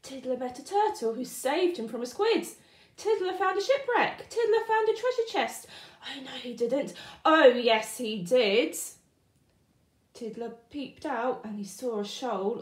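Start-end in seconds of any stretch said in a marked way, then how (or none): 3.0-5.7 high, loud and thrilled; excited build. Acted. Narrator thrilled at Tiddler's adventures, high. 7.3-8.7 loud panto call-back; pantomime shout. Acted. Narrator shouting the panto reply, loud.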